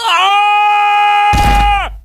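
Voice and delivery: long, high, held shout. Acted. Patient obeying the doctor's order, loud sustained "ah". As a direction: open up and hold a loud "ah".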